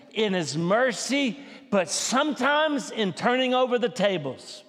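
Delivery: high, loud, slow fervent preaching shout; full-voiced revival preacher, slow and soaring; preaching at full force. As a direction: stretch every phrase and lift the room. Sermon climax.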